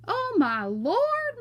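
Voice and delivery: high, loud, drawn-out shriek; horrified mother's slow scream. A narrator's reading. Mama sees her son stuck to the mirror, horrified scream. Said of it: "Mama screamed".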